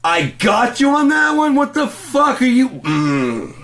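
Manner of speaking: very high, slow, strained yelling; incredulous shout, voice cracking up high; mocking furious yell. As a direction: throw his words back at him in a rage. Mocking the clerk's reply.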